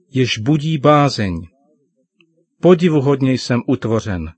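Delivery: solemn, measured reading of scripture; psalm reading. Acted. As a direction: read it out like a prayer from the Bible. Father reads psalm aloud. (otec slavnostně předčítá žalm synovi, odměřeně)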